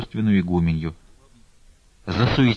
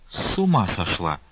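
plain reading voice

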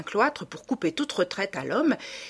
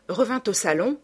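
usual speaking voice

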